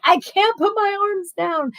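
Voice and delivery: put-on high, stiff-armed kid whining. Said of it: "they're gonna be like".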